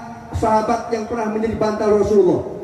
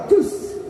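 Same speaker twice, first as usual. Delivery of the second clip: a short, high "cus" for the bite; snake strike hiss; 8 semitones higher than usual. Retold or imitated. Preacher to the audience, imitating a snake bite with a hiss.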